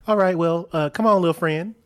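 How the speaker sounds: hesitant but projected beckoning; calling out. Acted. Chopin calls to her mage hand.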